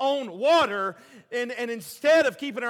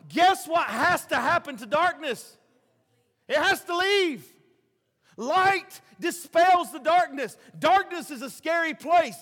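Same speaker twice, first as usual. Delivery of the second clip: loud, high preacher's cry; preaching; 5 semitones higher, 5 dB louder than usual. Acted. Pastor preaching hard to the church, loud and high. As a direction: preach it out with full force.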